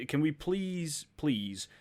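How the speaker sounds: high, slow drawn-out please; exasperated jokey plea to filmmakers; mock begging. Real, not acted. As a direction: stretch out the plea like begging a favour. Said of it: "I beg of every filmmaker".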